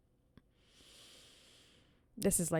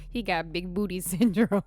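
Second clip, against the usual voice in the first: giggling through the line; can't hold the laugh in; 7 dB louder than usual. Retold or imitated. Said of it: "my brain went".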